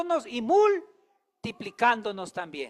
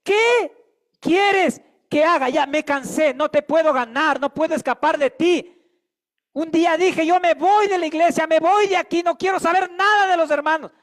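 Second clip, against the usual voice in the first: higher, louder surrender to God; mild mock exasperation, then surrender; surrendering plea; 5 semitones higher, 6 dB louder than usual. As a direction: voice a worn-out believer giving in. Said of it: "dígale como el apóstol".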